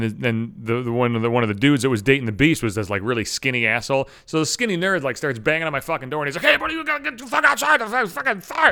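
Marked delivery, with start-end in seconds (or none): 6.4-8.7 high, fast, urgent warning from across the street; panicked neighbor. Retold or imitated. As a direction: yell it like the place is burning. Neighbor warns truck is burning.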